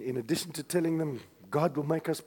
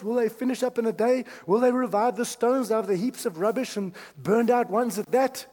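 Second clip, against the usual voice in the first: higher, mocking taunts; jeering; 8 semitones higher, 5 dB louder than usual. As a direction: sneer at the builders.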